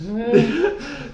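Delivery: a high burst of laughter; sudden high cackle. Real, not acted. Laughing at the joke.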